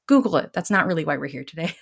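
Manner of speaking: ordinary talk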